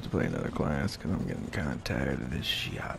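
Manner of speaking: whispered aside, barely voiced. Real, not acted. Gamer whispering a tired aside to viewers.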